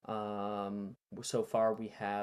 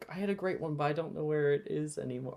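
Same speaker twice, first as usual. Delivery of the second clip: ordinary talk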